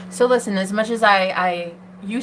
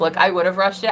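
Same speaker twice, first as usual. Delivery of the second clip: ordinary talk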